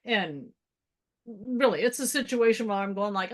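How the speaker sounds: normal talking voice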